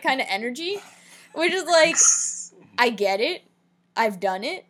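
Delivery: normal talking voice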